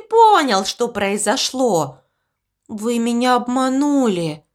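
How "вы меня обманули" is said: ordinary delivery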